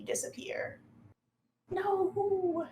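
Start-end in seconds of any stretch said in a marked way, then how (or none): 1.7-2.7 high, long falling wail; defeated villain cry. Acted. Monster falls back into the pool, high drawn-out cry. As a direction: cry out high as if dropping away.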